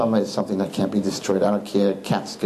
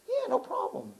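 much higher and much softer, airy and offhand; breezy reply; 10 dB quieter than usual. Retold or imitated. Rabbi casually agreeing to dance, high airy voice. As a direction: toss it off lightly and high. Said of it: "I'm like".

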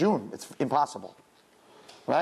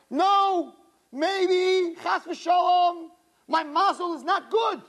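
high, loud, slowing fear; urgent worry; 10 semitones higher, 3 dB louder, much slower than usual. Acted. To the girls, a high loud worried plea. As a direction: voice the fear pleading.

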